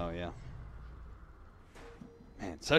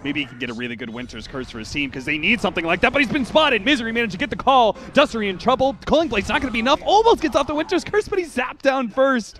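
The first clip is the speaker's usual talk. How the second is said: much higher, louder fight call; excited play-by-play; 11 semitones higher, 11 dB louder than usual. Real, not acted. Caster calling a sudden team fight, excited play-by-play. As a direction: build into full-force hype and stay there.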